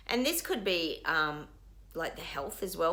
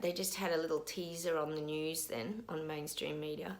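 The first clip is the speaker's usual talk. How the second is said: ordinary talk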